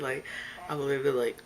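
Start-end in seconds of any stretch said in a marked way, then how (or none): none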